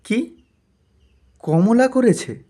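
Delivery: can't believe what you just heard; astonished husband; surprised husband. Acted. Husband learns wife cooked.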